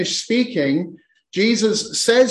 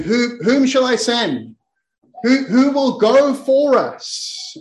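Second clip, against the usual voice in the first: voice of the Lord; 6 semitones higher, slower than usual. Acted. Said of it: "hears the voice of the Lord saying".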